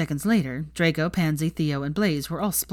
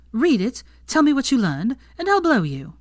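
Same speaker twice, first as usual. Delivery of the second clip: playful demand; 8 semitones higher, 4 dB louder than usual. A narrator's reading. Hermione teasing Draco.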